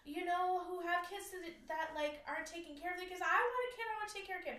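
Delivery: wife screaming at God in the shower, high and anguished. Retold or imitated. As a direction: yell up at God in despair. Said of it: "just screaming at God".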